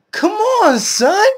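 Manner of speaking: high, loud yell of disbelief; exasperated shout. Real, not acted. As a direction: throw your voice up in exasperation. Exasperated cry at hypocrites.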